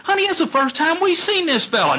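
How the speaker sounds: high pleading wife, lightly mocked; wife's voice. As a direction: play the worried wife calming her husband.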